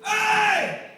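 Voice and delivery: very high, loud, long yell; hollered call. Retold or imitated. Biker hailing the church group, hollered call. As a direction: shout to grab attention from afar.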